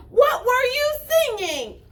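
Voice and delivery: put-on sharp insistent little girl; demanding. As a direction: squeeze the truth out of him. Girl squeezing the rabbit.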